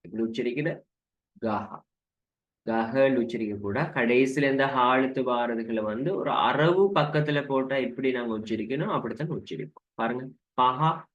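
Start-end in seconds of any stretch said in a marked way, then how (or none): none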